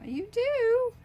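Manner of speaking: high surprised question; delighted surprise. Real, not acted. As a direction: shoot way up in pleased surprise. Reacting to a fan's note.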